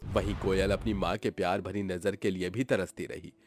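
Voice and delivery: ordinary delivery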